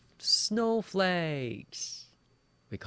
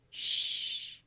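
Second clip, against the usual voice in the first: long whispered shush; 3 dB quieter than usual. Shown as a demonstration. Teacher recalls the third sound, shushing. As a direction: hold a hissing shush. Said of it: "remember that?".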